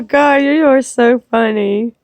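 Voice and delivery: ordinary talk, nothing marked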